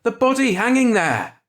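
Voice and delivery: a high, loud, frantic cry; panicked shout. A narrator's reading. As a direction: yell it at the crowd in terror. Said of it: "Ed shouted".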